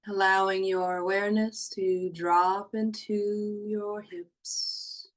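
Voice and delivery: hushed guiding voice for a body-awareness meditation; guided meditation. Acted. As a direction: ease them down into calm, slowly.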